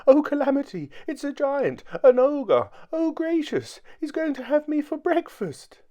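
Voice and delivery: very high, loud squeals of dread; terrified scream. A narrator's reading. Mister Jelly takes the tramp for a giant, screaming. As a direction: squeal as if about to be eaten. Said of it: "he screamed".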